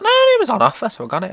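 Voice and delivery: breezy Karen. Retold or imitated.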